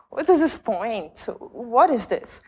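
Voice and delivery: ordinary talk, nothing marked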